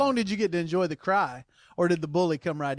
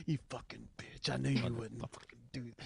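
a low, much softer menacing inner voice; bully's voice; 7 semitones lower, 13 dB quieter than usual. Pretend. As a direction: murmur it like the bully in your head. Voicing the bully at bedtime.

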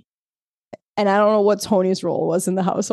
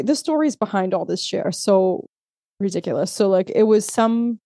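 normal talking voice

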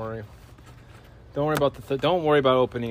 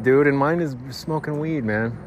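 usual speaking voice

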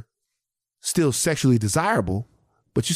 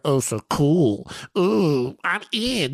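Ian; 2 semitones higher, much slower than usual. Retold or imitated. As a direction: put on a self-satisfied show-off voice. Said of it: "This is Ian right here".

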